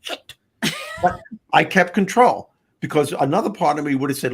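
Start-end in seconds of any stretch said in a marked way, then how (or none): 0.0-0.3 soft, private swear; said to himself. Retold or imitated. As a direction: drop it much softer, as a retold thought. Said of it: "I'm saying to myself".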